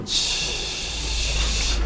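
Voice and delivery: voiceless ch hiss, stop then friction; whispered ch demo; isolated ch sound. Shown as a demonstration. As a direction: breathe out a soft ch with friction. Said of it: "Luego termina con fricción".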